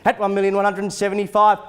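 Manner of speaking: auctioneer calling bids. Acted. Live auction bidding calls.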